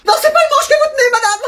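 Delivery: squeaky high character voice; a cartoonish high-pitched flight attendant; high put-on voice.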